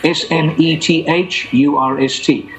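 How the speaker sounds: slow letter-by-letter spelling. Shown as a demonstration. Spelling his name slowly for the listeners.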